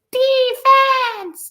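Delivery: put-on high, cracking old lady yell; little old lady cheering from the stands. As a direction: holler it like an eighty-five-year-old fan. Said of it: "some little old lady near the mic yelling".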